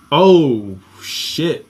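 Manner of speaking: ordinary delivery, nothing marked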